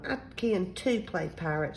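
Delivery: usual speaking voice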